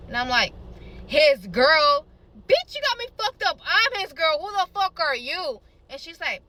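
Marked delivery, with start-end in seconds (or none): 1.1-5.6 raised, high-pitched acted fury; indignant confrontation. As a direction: challenge a rival on the phone. Acted quarrel with the other girl.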